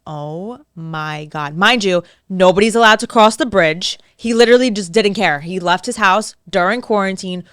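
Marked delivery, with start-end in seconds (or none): 0.1-1.5 low, very slow, hushed disbelief; retold dread. Daughter learning he is here, quietly dismayed. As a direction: drag it out under your breath, dismayed. Told he is at her house.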